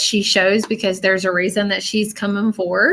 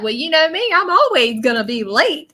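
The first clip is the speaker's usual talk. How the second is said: higher-pitched playful shrug; lightly voiced spirit; 6 semitones higher than usual. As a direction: channel her owning up cheerfully. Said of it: "that's how she's come through".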